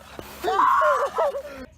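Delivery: big shrieking laugh. Real, not acted. Wild laugh at piling up.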